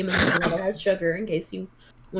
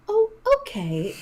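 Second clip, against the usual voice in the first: high waitress voice; 13 semitones higher than usual. Acted.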